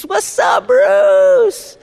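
mock rock shout. Mocking the name Bruce as a rocker's, high shouted greeting. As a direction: yell it like hyping up a rock star.

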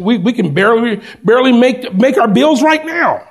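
building frustration over bills; mock fretting father.

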